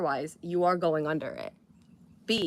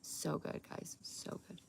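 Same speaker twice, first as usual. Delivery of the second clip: hushed; 17 dB quieter, slower than usual. Real, not acted. Hushed while sipping drink.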